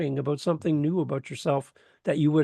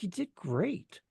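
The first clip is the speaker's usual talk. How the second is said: soft praise; 6 semitones higher, 7 dB quieter, much slower than usual. Retold or imitated. Mentor praising afterwards.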